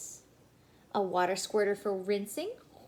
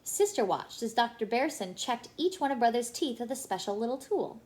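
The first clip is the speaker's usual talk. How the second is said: ordinary delivery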